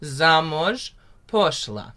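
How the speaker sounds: slow, carefully spoken "Yahodka u boru rosla" with rolled r; teacher modelling a Ukrainian line; slow Ukrainian demo. Shown as a demonstration. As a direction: spell out "Yahodka u boru rosla" slowly for learners. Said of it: "say the second line with me again".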